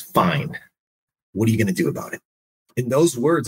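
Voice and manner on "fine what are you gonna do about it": a hint of a stern mother; mom's stern reply. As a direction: lightly voice mom, low and firm, daring him. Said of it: "And she goes".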